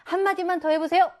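high, eager request; eager reporter. Acted. Reporter begging the winning dad for a quote, eager.

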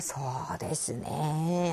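very slow, soft, drawn-out hedge; acted noncommittal stalling, low in energy; stalling for time. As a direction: stretch it out as if thinking it over. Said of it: "하면서 시간을 끌던가".

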